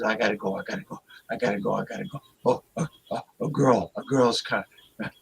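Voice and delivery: flustered mock stammer, trailing low; nervous. Host imitating Jordy fleeing a girl's contact, nervous. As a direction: imitate him getting nervous and stumbling over words. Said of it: "he got all nervous".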